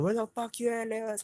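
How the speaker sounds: high-pitched challenging call. Retold or imitated. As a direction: call them out, pitch jumping way up. Calls out outside after threats.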